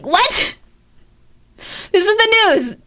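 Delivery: high, loud outraged protest; mock outrage at the news; indignant outburst. Real, not acted. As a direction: protest it, offended. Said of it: "I expect a little bit more professionalism".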